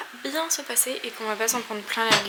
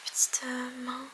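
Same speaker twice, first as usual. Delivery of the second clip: whispering; 7 dB quieter, slower than usual. Real, not acted. Whispered aside at start.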